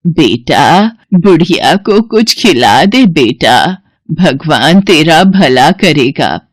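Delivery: put-on slow, pleading old woman's voice; old beggar woman. Old woman begs Somu for food, slow and frail. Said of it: "और सोमू से कहती हैं".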